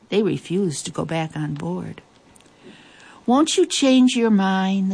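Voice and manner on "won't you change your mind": higher, slow, gently hopeful plea. Acted. Queen asking Captain Cat to stay, slow and hopeful. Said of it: "the queen said hopefully".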